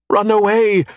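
high, loud urgent yell. A narrator's reading. Robot warns companions as the animals attack, yelling. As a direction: yell a warning at full pitch. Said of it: "I yell".

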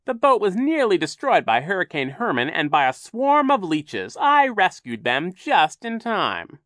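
high, loud theatrical villain; Captain Sham, boastful. A narrator's reading. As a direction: play up the heroic rescue grandly.

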